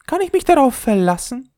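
very high, dainty ball voice; the leather ball snubbing the top, very high; haughty little ball. Acted. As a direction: look down your nose at the top.

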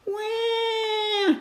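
put-on high drawn-out baby wah; baby wail. Baby Polly crying for food, high wail.

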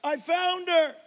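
high, loud call across the crowd; worker yelling. Retold or imitated. Disney worker yelling to the dad that she is found. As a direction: shout the good news over the crowd. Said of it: "they're yelling".

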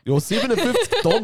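laughing. Real, not acted. Mocking friend's bad guess.

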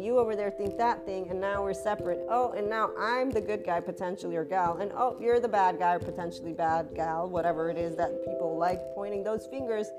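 ordinary delivery

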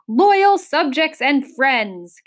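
loud, slow, high proclamation; grand, drawn-out kingly speech; royal address. A narrator's reading. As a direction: address a hall full of subjects. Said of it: "his voice echoing in".

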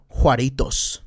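Juaritos said with Spanish pronunciation; Spanish twang. Shown as a demonstration. El Paso local showing off his border roots, Spanish twang. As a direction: slip into a Spanish accent on the place name. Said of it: "a little bit of that Spanish twang".

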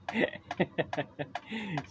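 high, soft chuckling laugh; real amused laugh. Real, not acted. Amused by daughter on the monitor, laughing.

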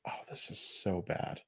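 hushed whisper of disgust; whispered thought. Retold or imitated. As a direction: breathe it out like a private groan. Inner thought, voiced as a whisper.

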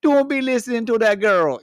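loud, high Jamaican baboon voice; Jamaican accent. Pretend. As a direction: call it out in a hammy Jamaican accent. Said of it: "like, a Jamaican accent".

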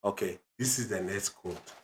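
put-on self-important African-accented announcer. Dad presents his own quote, African accent. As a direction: introduce it proudly in an African accent. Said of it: "African accent".

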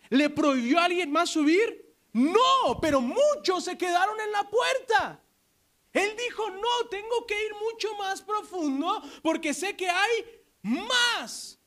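high, shouted preaching; preaching at full force. Acted. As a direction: cry it out to the whole church. Preaching climax about Moses. (predicación a gritos, aguda)

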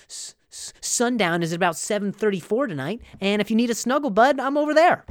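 high, bright-eyed camp host voice, lightly done; chipper camp host. Retold or imitated. Comedian voicing the campsite host, overeager. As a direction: play the overly friendly host.

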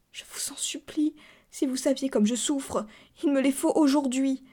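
high plea, starting hushed and urgent; tearful pleading. A narrator's reading. Harried cousin pleading with the narrator, tearful. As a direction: implore as if close to tears. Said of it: "Elle pleurait et bégayait".

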